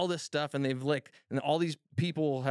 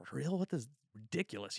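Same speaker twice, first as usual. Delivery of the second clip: hushed, half to himself in disbelief; quiet retold disbelief; stunned disbelief; 7 dB quieter than usual. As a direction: mutter it like you can't believe the rain. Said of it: "I was like".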